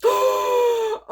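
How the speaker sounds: sharp shrieking gasp of delight; loud high gasp. Real, not acted. Seeing the star on the cover, loud gasp.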